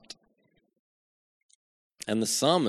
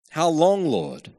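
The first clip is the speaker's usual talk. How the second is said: anguished cry; 4 semitones higher, 5 dB louder than usual. Acted. Preacher voicing the psalmist's cry to God, loud and anguished. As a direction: cry "How long" up high, let "Lord" fall.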